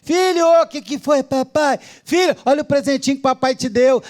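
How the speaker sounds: a hint of a fond father showing off a gift; doting father. Father handing his son a present, lightly doting voice.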